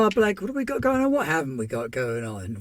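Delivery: high, fast hype-man opener; showman's hype. Real, not acted. Host kicking off his channel news, high and fast. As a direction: throw it out like a game show host.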